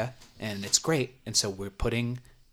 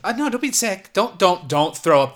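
panicked; 7 semitones higher, 8 dB louder than usual. Acted. Customer about to vomit.

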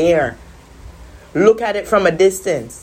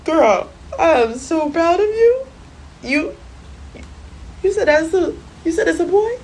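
high, gushing delight at a stranger; delighted praise; 11 semitones higher than usual. Retold or imitated. Mother gushing at a woman who guessed her son right. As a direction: gush with pride at the woman. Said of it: "I was like".

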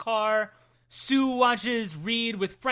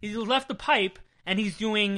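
ordinary talk

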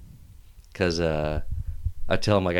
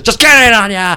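mock cop taunt; 12 semitones higher, 13 dB louder than usual. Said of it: "He goes".

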